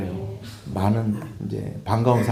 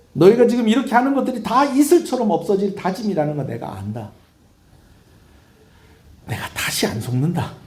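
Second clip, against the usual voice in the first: high, raised preaching voice; forceful rebuke; 8 semitones higher, 3 dB louder than usual. Acted. God on pledges vanishing like dew, forceful rebuke. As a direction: press the accusation hard, then let it drop.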